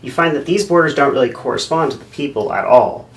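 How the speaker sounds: loud, bubbly explainer voice; instructional video host. Acted. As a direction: overeager presenter selling maps. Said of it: "Here's an instructional video".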